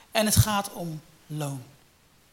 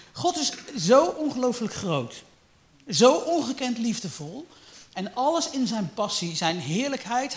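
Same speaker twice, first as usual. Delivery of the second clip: higher and louder, slowing on the last word; preaching in awe; 6 semitones higher, 5 dB louder than usual. Real, not acted. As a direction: lift the voice in awe of God. Preaching about God's greatness. (hoog en luid vol ontzag gepredikt)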